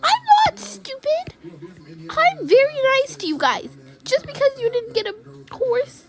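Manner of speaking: very high, fast retort; indignant protest. Pretend. Girl called stupid by friends, indignant. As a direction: protest quickly in a squeaky-high voice. Answers an insult in quarrel.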